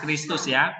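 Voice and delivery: usual speaking voice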